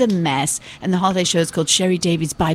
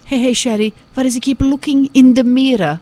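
higher, louder Hungarian Dracula voice; Hungarian Dracula accent; 5 semitones higher, 5 dB louder than usual. Retold or imitated. Daughter imitating father questioning her in cab, raised Hungarian Dracula voice. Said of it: "he sounds like Dracula".

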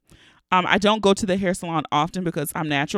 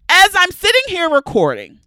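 excited; 7 dB louder than usual. Real, not acted. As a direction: blurt out the big news in excitement. Excited news of a rematch.

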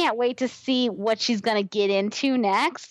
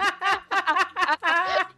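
laughing; 8 semitones higher than usual. Real, not acted. Laughing at own joke.

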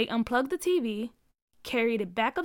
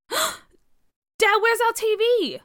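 shocked; 12 semitones higher, 8 dB louder, much slower than usual. A narrator's reading. Discovering the TV is gone.

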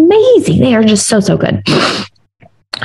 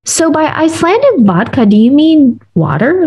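higher, puzzled question to the waiter; incredulous; 7 semitones higher than usual. Retold or imitated. Asking the waiter about the vodka, high incredulous question. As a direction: ask it like you can't believe it. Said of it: "really confused".

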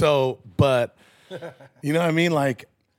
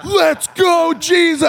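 hype shout; 10 dB louder, slower than usual. Retold or imitated. Hyping the crowd.

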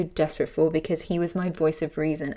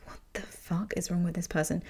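unvoiced, much softer aside; whispered thought; 8 dB quieter than usual. To herself after a stranger's boo, whispered. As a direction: hush it like a shocked private thought. Startled inner thought in bathroom.

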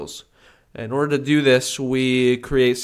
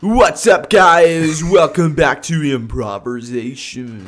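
show host voice; 4 semitones higher, 8 dB louder, slower than usual. Acted. Hosting intro of improv segment.

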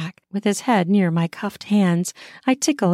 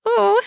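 short high-pitched squeal; 4 dB louder than usual. A narrator's reading. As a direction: let out a sudden high squeal. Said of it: "I squeal".

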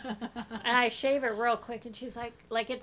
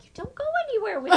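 high, loud, fast laughing comeback; laughing retort; 11 semitones higher, 10 dB louder, faster than usual. Retold or imitated. Mom laughing back at her annoyed daughter. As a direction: fire it back through laughter. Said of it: "I'm like".

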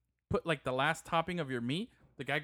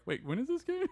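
mock surprise; 12 semitones higher, 3 dB quieter than usual. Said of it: "Pedro's like".

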